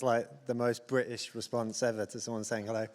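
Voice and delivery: usual speaking voice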